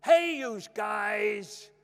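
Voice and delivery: high, loud, drawn-out army wake-up call; imitating Dad's American army shout; father shouting. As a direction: bellow it like a drill sergeant rousing the troops. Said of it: "would be my father shouting".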